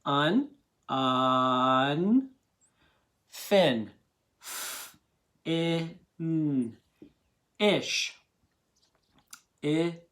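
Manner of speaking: "un", "fin", "ish" stretched apart with pauses; sounding out each part; sounded out. Shown as a demonstration. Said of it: "one part at a time".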